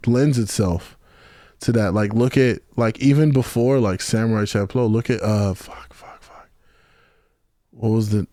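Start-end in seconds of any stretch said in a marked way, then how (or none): 5.5-6.5 real whispered swearing while racking your memory; whispered cursing. Real, not acted. Guest failing to remember an anime's name, whispered cursing. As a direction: whisper it to yourself, barely audible. Trying to recall an anime title.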